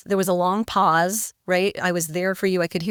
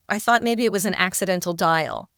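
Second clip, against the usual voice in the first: ordinary talk, nothing marked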